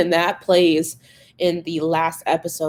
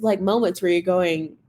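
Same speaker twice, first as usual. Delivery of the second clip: ordinary delivery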